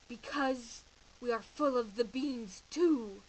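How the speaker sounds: dramatic emphasis. Acted. Chihuahua explaining why the bandit comes, loud and slow. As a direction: lean in hard on it.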